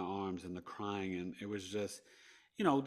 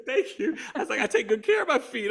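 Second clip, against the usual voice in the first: higher and louder, laughing through the words; laughing thanks; 11 dB louder than usual. Retold or imitated. Patient thanking the nurses for a compliment, laughing.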